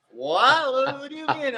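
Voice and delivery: high startled "what do you mean"; shocked follower. Retold or imitated. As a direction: react like you just heard something outrageous. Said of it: "They're like".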